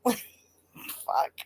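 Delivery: ordinary delivery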